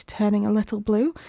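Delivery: plain reading voice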